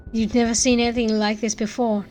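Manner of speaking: usual speaking voice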